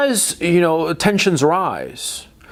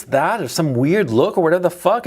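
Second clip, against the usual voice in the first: usual speaking voice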